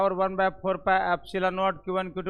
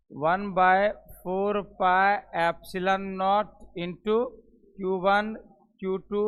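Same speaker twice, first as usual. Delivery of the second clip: formula read very slowly; teacher dictating the formula; slow dictation; much slower than usual. Shown as a demonstration. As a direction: dictate it word by word. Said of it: "अब फिर सुनिएगा".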